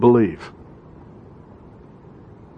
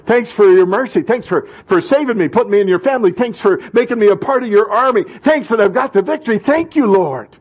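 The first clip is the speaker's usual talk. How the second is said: higher-pitched, exultant prayer of thanks; thankful prayer; 7 semitones higher than usual. Acted. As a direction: pile up the thanks to God, voice raised in pitch. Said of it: "That's what we did this morning".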